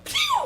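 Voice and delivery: squeaky pew sound. Acted.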